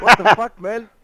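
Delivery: shocked shout. Real, not acted. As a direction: yell it out in total shock. Shocked at friend lying unconscious.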